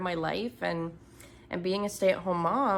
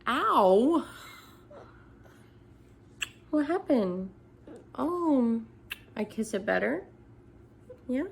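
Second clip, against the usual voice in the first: kissing it better; 6 semitones higher than usual. Real, not acted. Mom comforts hurt child.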